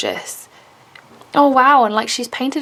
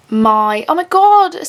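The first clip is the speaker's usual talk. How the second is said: high, loud thrilled exclamation; sudden joyful squeal of surprise; excited gasp; 6 semitones higher, 5 dB louder, slower than usual. Real, not acted. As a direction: burst out in real delight. Opening a fan-made gift.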